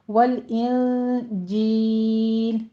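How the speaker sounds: وَالْإِنْجِيلَ chanted slowly with a plain j in جیل; correct pronunciation. Shown as a demonstration. Teacher modelling the word for students, slow chant. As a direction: recite وَالْإِنْجِيلَ slowly, unaspirated. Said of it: "جیل کی sound آئے گی".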